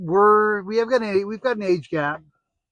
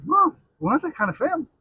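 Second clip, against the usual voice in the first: higher, quick scolding mom voice; a lightly voiced mother telling her off; mock scolding mom; 8 semitones higher, faster than usual. Mom scolding the joke at family table, quick and high.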